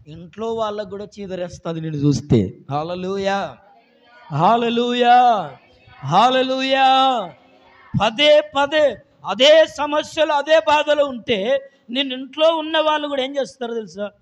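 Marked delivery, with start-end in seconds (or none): 4.3-7.3 loud, drawn-out hallelujah; shouted hallelujah. Acted. 8.0-13.2 fervent shout over the congregation; preaching shout. Acted.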